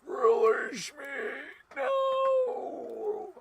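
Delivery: high, slow breathy alien shriek. Retold or imitated. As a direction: screech like the movie alien. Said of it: "This is the alien from Independence Day".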